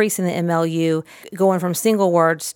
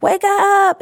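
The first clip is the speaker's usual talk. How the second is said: much higher, bright and bouncy wake up; big exciting wake up; 14 semitones higher, 5 dB louder than usual. Shown as a demonstration. Waking the toy person for the child, much higher and excited. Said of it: "is kind of big and exciting".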